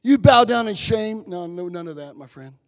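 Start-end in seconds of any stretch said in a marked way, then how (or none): 0.0-1.2 loud, harsh berating father; a condemning father's rebuke; mock scolding father. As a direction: scold like a shaming parent. Imagined scolding father, rejected.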